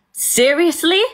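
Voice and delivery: high-pitched, disbelieving question; incredulous. Real, not acted. Storyteller reacting to his call after the attack, incredulous.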